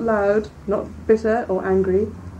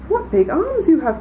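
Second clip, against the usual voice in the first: higher, lightly voiced child in wonder; girl questioning the disguised wolf in wonder; wondering little girl; 8 semitones higher than usual. A narrator's reading. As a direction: marvel at it like a small girl.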